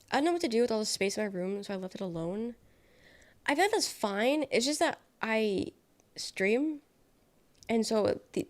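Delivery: ordinary talk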